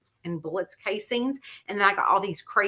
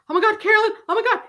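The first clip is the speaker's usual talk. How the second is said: freaked out; 11 semitones higher, 5 dB louder, much faster than usual. Retold or imitated. Spooked on phone to Carolyn.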